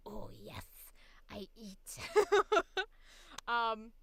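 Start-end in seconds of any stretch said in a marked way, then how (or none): 0.1-2.0 villain voice. Acted. Finally cast as a villain, gloating quietly. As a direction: savor it like a villain about to feast. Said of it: "when I do, it's like".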